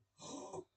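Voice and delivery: sharp high gasp of shock; mock gasp. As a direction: gasp as if scandalised. Gasp at swearing.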